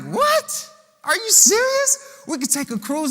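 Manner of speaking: high, stunned squeak of disbelief; can't believe what the teacher just proposed; shocked disbelief. Acted. Disciples gasping at Jesus' cruise idea, high and stunned.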